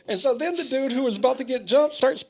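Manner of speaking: usual speaking voice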